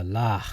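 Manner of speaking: "lah" ending in an audible H; emphasis on the H. Shown as a demonstration. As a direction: let "lah" end on a stressed H. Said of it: "with emphasis on the H".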